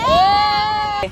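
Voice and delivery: loud, high, drawn-out cheer; happy cheer. Real, not acted. Companion glad they made it in time, happy cheer.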